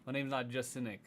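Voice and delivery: usual speaking voice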